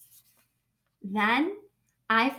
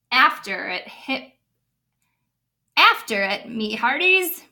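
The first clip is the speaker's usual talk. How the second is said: louder, slow pirate order; lightly voiced captain's order; captain commanding; 2 semitones lower, 5 dB louder, slower than usual. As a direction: command the crew after the ball. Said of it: "Braid Beard commanded".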